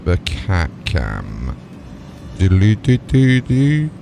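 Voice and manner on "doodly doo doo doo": ordinary talk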